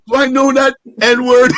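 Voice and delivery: high mock confused question; lightly acted bewildered guy; puzzled stranger. Imagined puzzled look voiced.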